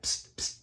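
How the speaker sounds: two soft voiceless psst noises; imitated hissy psst-psst, no voice. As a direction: make a soft psst sound, twice. Imitates Julio's habitual noise.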